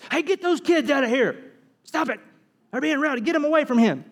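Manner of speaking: high, urgent scolding yell; shooing shout. Acted. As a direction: shoo rowdy kids away from the teacher. Disciples shooing the children away.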